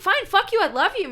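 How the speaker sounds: very high, loud affectionate shout; loving yell. Retold or imitated. Shouting back at his girlfriend, angry yet loving. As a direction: yell the insult and the love together. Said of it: "I was like".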